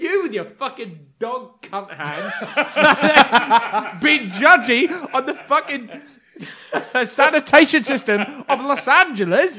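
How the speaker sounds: laughing through a mock rant, slow. Mocking her judgy comment, laughing through it. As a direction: barely get it out for laughing. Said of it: "And I'm like".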